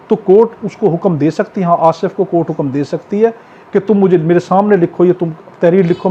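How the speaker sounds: ordinary talk, nothing marked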